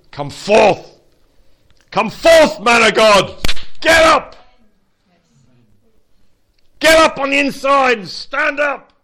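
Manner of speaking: loud, high commanding call; strong comfort. Acted. Preacher calling a man of God to rise, loud. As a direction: rouse him like raising the dead. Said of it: "That's comfort".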